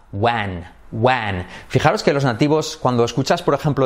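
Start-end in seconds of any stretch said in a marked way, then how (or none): 0.1-1.4 when said wan, E so open it is nearly A. Shown as a demonstration. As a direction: when with a wide open vowel, almost wan. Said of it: "la E hay que hacerla más abierta".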